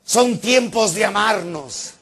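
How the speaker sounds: high, slow preachy call; drawn-out pious proclamation; mock evangelizer. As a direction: imitate a door-to-door preacher proclaiming. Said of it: "me dice uno de esos evangelizadores".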